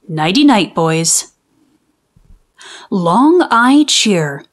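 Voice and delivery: ordinary delivery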